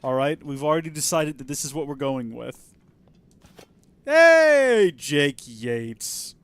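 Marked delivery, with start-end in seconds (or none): 4.1-6.3 calling out. Real, not acted. Calling out to viewer.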